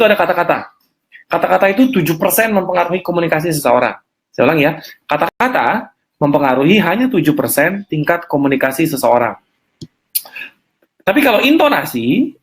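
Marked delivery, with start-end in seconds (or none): none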